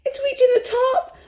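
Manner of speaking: high, distressed near-laughing wail; panicked cry. A narrator's reading. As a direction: cry it out as the dough overflows. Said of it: "I cried out".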